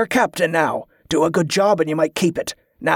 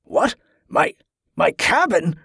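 incredulous, pitched-up sputter; shocked; 3 semitones higher than usual. A narrator's reading. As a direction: stammer in disbelief. Shocked to learn who the traitor is.

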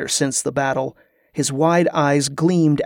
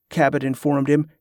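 plain reading voice

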